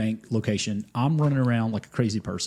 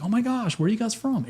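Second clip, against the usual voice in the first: much higher, bright excited squeal; excited quote; 12 semitones higher than usual. Retold or imitated. Barista greeting new arrivals, excited high voice. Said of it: "she's like".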